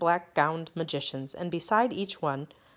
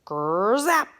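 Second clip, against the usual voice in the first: loud buzzing growl of an arrow; hornet arrow buzz; 4 semitones higher, 7 dB louder than usual. A narrator's reading. Arrow tearing toward Bartholomew, buzzing growl. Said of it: "Like a mad giant hornet".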